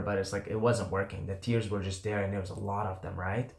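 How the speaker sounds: ordinary delivery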